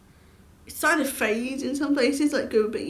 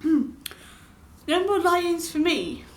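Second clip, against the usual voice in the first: slow, higher, drawn-out wail; mock lament; 6 semitones higher, much slower than usual. Vlogger mourning lost lie-ins, slow mock lament. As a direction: stretch it out like a tragedy.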